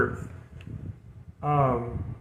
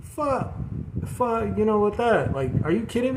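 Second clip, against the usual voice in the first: high, incredulous mock outburst, swearing at the news; a pitched-up cry of disbelief at a tough reading; exasperated disbelief; 8 semitones higher than usual. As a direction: throw up your hands at hard news. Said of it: "it's like".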